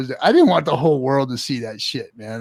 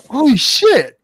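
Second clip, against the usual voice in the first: high, louder stunned exclamation; retold astonishment; 11 semitones higher, 6 dB louder, slower than usual. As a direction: let the shock jump up in pitch. Retold shock at film set.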